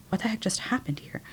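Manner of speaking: hushed, whispered disbelief; stunned whisper. Retold or imitated. Recalling what went through her mind afterwards, stunned whisper. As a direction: whisper it as a private, stunned thought.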